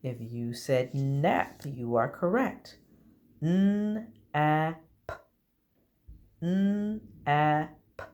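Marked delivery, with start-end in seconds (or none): 3.4-8.0 n, a, p said as separate sounds; segmented sounds. Shown as a demonstration. Teacher blending words for the class, sound by sound. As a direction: sound out n, a, p one by one. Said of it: "spells nap".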